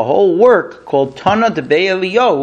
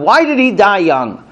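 ordinary talk

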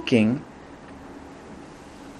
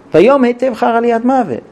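defiant retort; 6 semitones higher, 4 dB louder than usual. Acted. Yonah answers angrily.

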